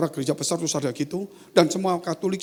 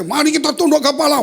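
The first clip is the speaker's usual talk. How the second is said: loud, high preaching call; high, projected pulpit command; full voice returned; 10 semitones higher, 7 dB louder than usual. Retold or imitated. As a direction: summon the congregation at full voice. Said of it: "kok keluar semua?".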